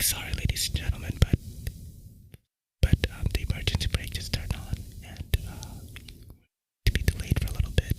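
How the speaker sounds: whispered train announcement, barely audible; whispering. Retold or imitated. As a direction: imitate a conductor whispering the announcement. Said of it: "like, whispering, bro".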